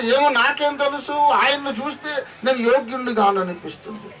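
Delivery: put-on high, bewildered little boy; a boy who cannot explain his tears, high; high child voice. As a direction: answer like a puzzled child, high. Child self answering, retold.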